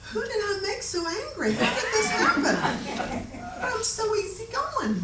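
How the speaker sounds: high-pitched, laughing disbelief; mock bewilderment. Author's friend wondering at an angry caller, mock bewildered. Her bewildered thoughts during his tirade.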